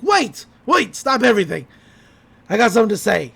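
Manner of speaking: loud frantic calls to stop; shouting. Retold or imitated. Stan crashing in, shouting at the crowd. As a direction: yell to halt everyone.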